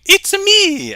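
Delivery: high squeaky Mario impression. Reading chat aloud as Mario, high and loud.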